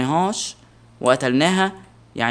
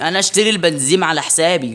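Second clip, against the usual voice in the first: ordinary delivery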